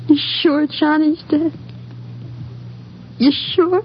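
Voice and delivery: clinging to a last hope; begging to be told it isn't true; desperate disbelief. Acted. Girl asks if fiancé dead.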